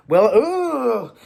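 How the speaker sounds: ordinary talk, nothing marked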